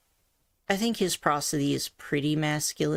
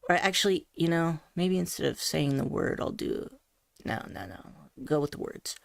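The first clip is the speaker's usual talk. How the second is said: usual speaking voice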